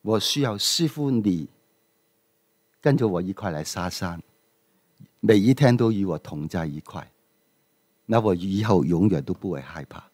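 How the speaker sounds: a humble student asking the master to stay with him; sincere disciple. Acted. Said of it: "这个徒儿就说".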